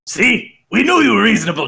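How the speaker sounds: high, pleased goblin. Acted. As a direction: pipe up triumphantly as a goblin. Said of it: "the one that hasn't been talking goes".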